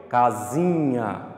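"casinha" stretched out slowly; sílaba por sílaba. Shown as a demonstration. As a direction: draw out each syllable of "casinha". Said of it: "eu já falei a palavra, já fazendo a separação". ("casinha" devagar, alongando as sílabas)

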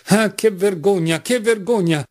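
lamento di vergogna, lento e più acuto; esclamazione vergognata. A narrator's reading. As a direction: esclama con un tocco di vergogna sincera. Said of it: "arrossendo davvero per la vergogna". (vergogna esclamata, contenuta)

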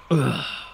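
drawn-out broken burp; gurgling belch in the throat. A narrator's reading. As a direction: let out a series of small belches. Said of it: "he belched".

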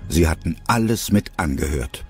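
ordinary delivery

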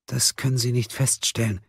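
high, fragile-sounding suspect voice; troubled patient. A narrator's reading. Marlin explains her illness to the prosecutor, high and subdued.

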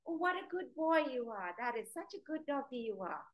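high, sweet praise for a good dog; happy praise voice. Shown as a demonstration. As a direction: gush over the dog like it's the best boy. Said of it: "So I'd be like".

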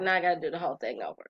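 fast, grumbling rattle of annoyance; frustrated rush. Retold or imitated. Retelling a filing mistake, a fast frustrated grumble. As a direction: gabble it under your breath.

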